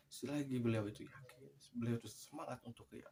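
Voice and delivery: ordinary delivery, nothing marked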